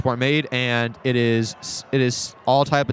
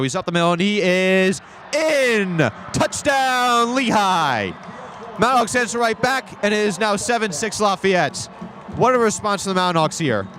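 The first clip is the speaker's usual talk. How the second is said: touchdown call; 8 semitones higher than usual. Real, not acted. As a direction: yell the score with excitement. Touchdown scored.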